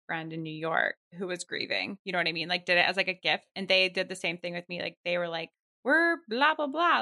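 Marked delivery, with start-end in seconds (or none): 5.8-7.0 higher blah-blah patter; mimicked service chatter. Friend mimics the delivery service, mock chatter. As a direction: imitate someone rattling on.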